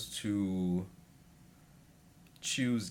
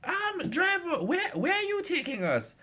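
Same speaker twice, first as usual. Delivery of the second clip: put-on loud old lady announcement; 5 dB louder than usual. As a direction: call to the driver like a prim old lady. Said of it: "she announced very loudly".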